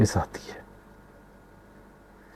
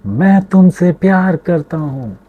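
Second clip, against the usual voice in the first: high, loud call into the distance; father calling toward the mountains; calling out to the hills; 8 semitones higher, 4 dB louder than usual. Acted. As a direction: send the words out across the mountains. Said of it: "पहाड़ों की तरफ मुंह करके कहा".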